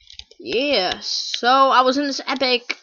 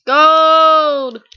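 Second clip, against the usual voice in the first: long, loud, higher yell; excited shout; 4 semitones higher, 10 dB louder than usual. Real, not acted. Gamer finding gold, drawn-out excited shout. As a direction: belt out the discovery.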